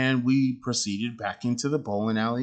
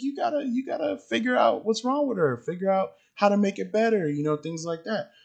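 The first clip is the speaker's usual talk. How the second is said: much higher, straining exasperated plea; exasperated squeal; 10 semitones higher than usual. Retold or imitated. Urging the dad to fix his crying kid, pitched very high. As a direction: squeak it out like you can't believe him.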